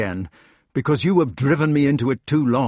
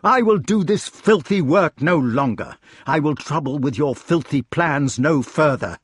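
higher-pitched, indignant tirade; raging in self-defense; 6 semitones higher, 3 dB louder than usual. A narrator's reading. Cornered king berating the clever meddlers, raging. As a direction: lash out at them, wounded and defiant. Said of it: "he had to rage in self-defense".

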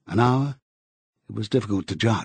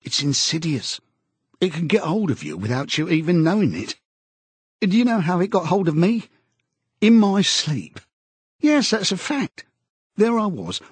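high-pitched, confiding amazement; earnest, agitated Parsons; 6 semitones higher than usual. Acted. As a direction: confide it in a strained high voice, falling off towards the end.

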